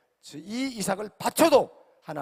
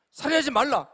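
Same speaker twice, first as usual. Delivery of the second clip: louder and higher; emphatic proclamation; 5 semitones higher, 5 dB louder than usual. Shown as a demonstration. Preacher thundering the commandment at the congregation, loudly. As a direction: declare 살인하지 말라 with full preaching force.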